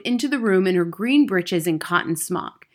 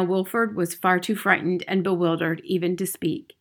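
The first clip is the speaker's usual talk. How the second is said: ordinary delivery, nothing marked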